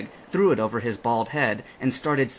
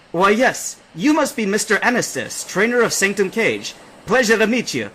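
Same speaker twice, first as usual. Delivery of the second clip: higher, louder hearty greeting; captain's greeting; 9 semitones higher, 7 dB louder than usual. A narrator's reading. The captain welcoming the trainers, hearty greeting. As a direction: call it out warmly.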